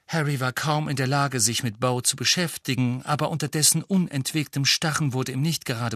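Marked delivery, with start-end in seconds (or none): none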